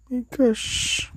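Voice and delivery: high, exasperated my gosh. Real, not acted. Exasperated exclamation.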